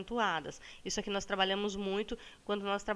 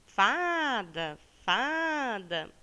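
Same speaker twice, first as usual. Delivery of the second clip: "fada" stretched on its first syllable, as if calling it; calling the word; 3 semitones higher, 4 dB louder, much slower than usual. Shown as a demonstration. Teacher shows pupils the stressed syllable, calling "fada" drawn out. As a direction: call "fada" with a long, swooping FA. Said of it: "chama a palavrinha".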